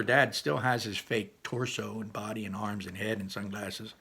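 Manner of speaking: usual speaking voice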